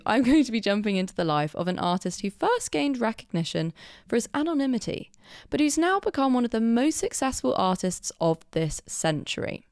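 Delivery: ordinary talk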